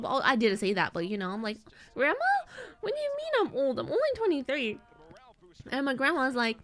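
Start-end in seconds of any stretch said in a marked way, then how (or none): 1.9-4.8 high-pitched indignant protest; acted wounded outrage; mock offended. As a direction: protest to grandma in squeaky disbelief. Acted protest at being called old.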